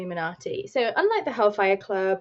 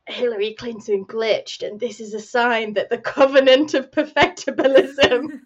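conspiracy YouTuber; 8 dB louder than usual. Retold or imitated. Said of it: "some guy is like".

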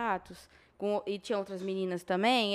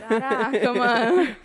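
laughing exclamation; 10 dB louder, slower than usual. Retold or imitated. Reacting to thirty K earnings.

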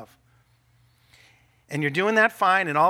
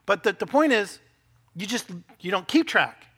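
ordinary talk, nothing marked

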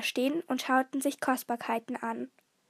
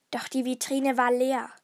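plain reading voice

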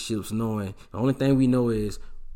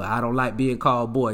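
normal talking voice

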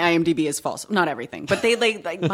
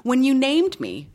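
mock indignant; 9 semitones higher, 3 dB louder, slower than usual. Retold challenge to parents.

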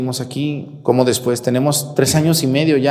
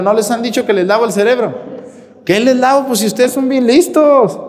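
incredulous exclamation; 8 semitones higher, 3 dB louder than usual. Real, not acted. Preacher mocking brainwashing claim.

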